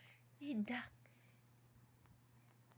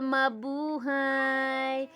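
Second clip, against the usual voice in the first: drawn-out greeting; much slower than usual. Shown as a demonstration. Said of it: "Say hi to madlang people".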